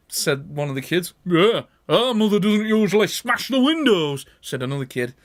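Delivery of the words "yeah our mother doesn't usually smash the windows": put-on cheeky kid. Another kid.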